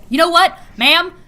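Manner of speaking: a mock-outraged ma'am, stop; exasperated scolding. Real, not acted. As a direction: tell the author off, fed up. Exasperated rant at author.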